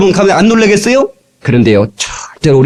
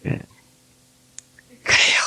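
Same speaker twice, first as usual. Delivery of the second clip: whisper; 10 dB quieter than usual. Real, not acted. Sudden hushed aside.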